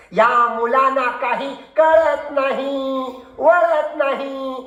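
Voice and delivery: slow, drawn-out lament over the pupils; schoolmaster's long-drawn catchphrase; exasperated teacher. Acted. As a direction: stretch each phrase out in weary exasperation.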